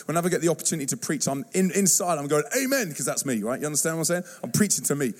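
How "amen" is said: higher-pitched, enthusiastic amen; inner cheer. Retold or imitated. Preacher inwardly answering his own sermon, cheering. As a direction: cheer yourself on like a congregation.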